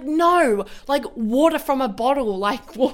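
mock frustrated insistence; imitating an annoyed guide; retold angry guide. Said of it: "he said he got angry".

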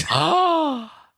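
sharp high gasp; gasp of shock. Real, not acted. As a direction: gasp in surprise. Gasps at kiss on screen.

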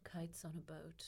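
whispered title; hushed whisper. Pretend. Trying out a book title for colleagues, whispered. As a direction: whisper it slowly, very softly.

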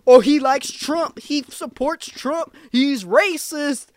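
slow sneering critic impression; mocking bozos. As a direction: drag it out to mock the haters. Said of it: "these bozos start saying".

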